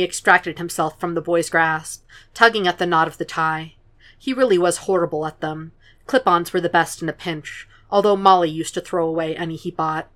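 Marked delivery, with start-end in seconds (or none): none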